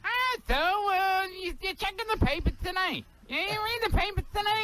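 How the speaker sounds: a high, chatty late-night host; Jay Leno impression. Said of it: "Do your Jay Leno".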